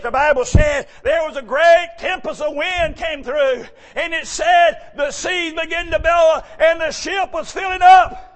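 loud, high storm-story preaching; preaching at full force. Acted. Preacher shouting the storm on Galilee to the church.

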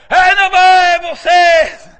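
slow, loud street-preacher call; megaphone preaching. Retold or imitated. Preacher on the Walmart corner with megaphone, slow booming call. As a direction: boom it out like through a megaphone. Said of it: "He is over there".

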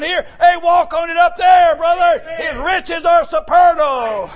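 slow, drawn-out preacher cry; preaching. Acted. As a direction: proclaim it then whoop. Preaching at full force.

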